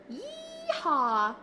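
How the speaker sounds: normal talking voice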